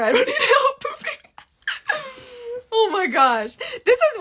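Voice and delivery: high, laughing through words. Real, not acted. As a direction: crack up mid-sentence. Laughing at joke.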